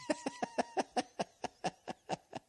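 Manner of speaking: breathy high laugh, tailing off; a real laugh at his own punchline. Real, not acted.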